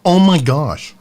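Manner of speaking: loud, high burst of amazement; amazed reaction. Real, not acted. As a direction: blurt it out, blown away by the taste. Amazed reaction to first taste.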